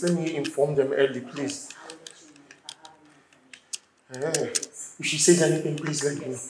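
very soft, low, hesitant; meek pleading. Acted. Husband asking nurse about the accident, hushed meek pleading. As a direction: beg quietly, stopping and starting.

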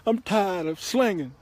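high, slow weepy whine; mock tearful. Crying thug quitting the trade, mock tearful. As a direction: wail it in hammed-up despair. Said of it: "thug life to start crying".

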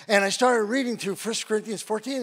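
ordinary talk

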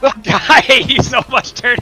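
laughing. Acted. Laughing mock insult.

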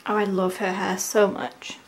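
normal talking voice

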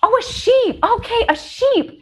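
high, dawning "Oh, a sheep"; the penny drops, high; sudden realisation. Retold or imitated. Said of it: "And I was like".

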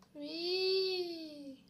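high, sung-out whee of delight; gleeful whee. Real, not acted. Gamer flying off the ramp, squealing with glee. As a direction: let it fly up like a ride.

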